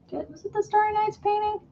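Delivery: high, soft puzzled question; high unsure question. Real, not acted. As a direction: wonder aloud, high and soft.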